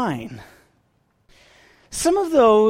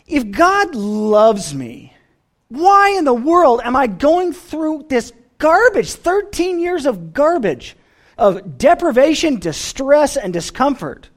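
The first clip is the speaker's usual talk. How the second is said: raised pitch, pleading complaint; acted exasperation; 7 semitones higher, 4 dB louder than usual. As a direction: protest to God, drawing out the grievance. Voices Joseph questioning God in exasperation.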